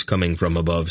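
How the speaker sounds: ordinary delivery, nothing marked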